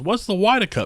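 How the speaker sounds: put-on high, loud old lady voice; a squeaky old lady asking; high-pitched old lady. Lady's quote retold again.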